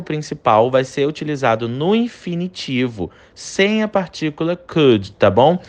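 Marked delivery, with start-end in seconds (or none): none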